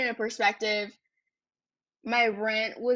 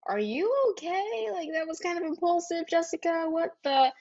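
put-on much higher, fast, worried boyfriend voice; imitating boyfriend; 8 semitones higher than usual. Boyfriend questioning her impulsive quitting, higher mock-worried voice. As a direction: play the freaked-out boyfriend in a higher voice. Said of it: "he's like".

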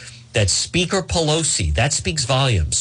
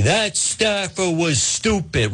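mock defender; 5 semitones higher, slower than usual. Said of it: "guys that were defending McKee".

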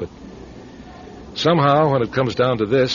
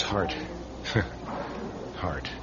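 soft bitter chuckle; 3 semitones lower, 10 dB quieter than usual. Acted. Bitter joke at bedside.

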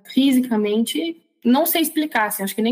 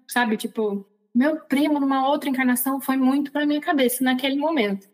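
ordinary delivery

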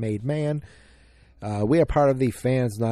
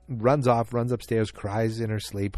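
ordinary talk

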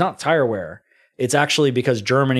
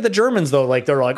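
ordinary talk

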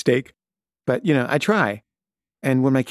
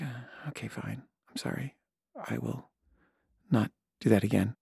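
much softer guilty whisper; sheepish whisper; 10 dB quieter than usual. Retold or imitated. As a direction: barely breathe it, caught out and giving in. Dad caught, sheepishly gives in.

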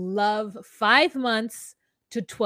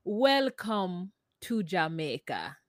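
slow, careful Jamaican accent demo; unhurried put-on Jamaican accent; 3 semitones lower, 3 dB quieter, slower than usual. Shown as a demonstration. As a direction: spell out the Jamaican lilt slowly for a learner.

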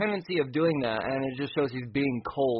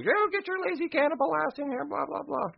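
shrill squeaky yell at a lazy employee; boss yelling. Retold or imitated. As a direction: bark the order like an angry boss.

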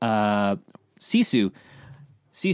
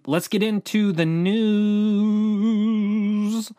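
high, stretched-out sing-out on news; drawn-out announcement; 9 semitones higher, 3 dB louder, much slower than usual. Real, not acted. Host kicks off the news segment, drawn out. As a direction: sing out the segment intro, holding the last word.

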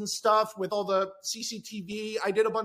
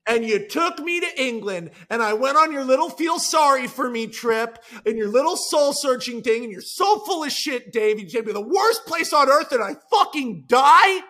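loud, bitter tirade at a friend; angry rant; 4 semitones higher, 6 dB louder than usual. Acted. Jack berating David for the England trip, loud and angry. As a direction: tear into him, louder and higher.

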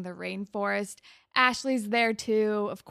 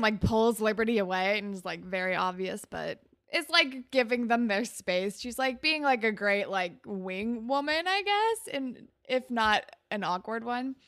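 usual speaking voice

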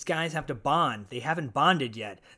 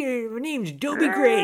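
put-on high slow old prospector voice; 10 semitones higher, 6 dB louder than usual. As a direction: drawl it like a wild old prospector. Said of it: "He's like an old prospector".

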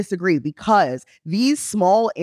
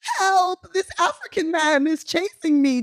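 mock damsel cry; 8 semitones higher than usual.

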